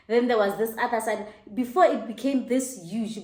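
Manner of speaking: ordinary talk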